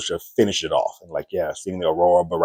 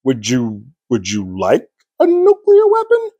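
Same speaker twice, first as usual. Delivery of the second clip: much higher, slow, sing-song offer; eager Joined voice; 5 semitones higher, 4 dB louder, slower than usual. Retold or imitated. The Joined cheerfully offering Carol a nuke, high and slow. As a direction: dangle it like a cheerful waiter.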